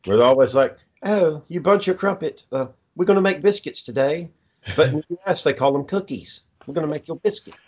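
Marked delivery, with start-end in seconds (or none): 1.0-4.3 higher-pitched mock British baking contestant; British accent. Imitating British baking show bakers, mock British accent. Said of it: "I can practice on my accents". 6.7-7.6 mock British accent. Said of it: "The Great British Bacon fucking thing".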